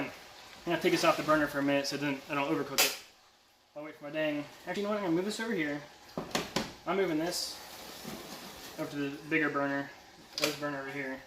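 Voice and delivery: usual speaking voice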